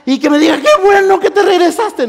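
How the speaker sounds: high, loud mock pleading; pleading wish. Y dije.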